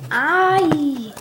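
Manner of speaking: loud, high, drawn-out groaned word; acted exasperation. Friend learns it was a prank, acted exasperation.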